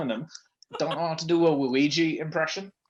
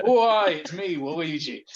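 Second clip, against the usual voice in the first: Manchester Luigi; 3 semitones higher, 3 dB louder, slower than usual. Acted. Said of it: "Why is Luigi from Manchester?".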